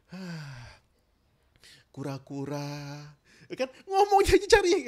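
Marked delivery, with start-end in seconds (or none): 0.1-3.1 soft, slow, gentle sigh of a word; soft and relaxed. Shown as a demonstration. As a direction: let the body go limp and say it softly. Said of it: "kalau misalnya tangan lemes".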